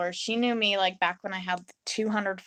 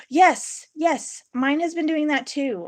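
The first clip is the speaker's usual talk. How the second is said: excited gasp; 8 semitones higher, 7 dB louder, slower than usual. Real, not acted. Reacting to a chat comment.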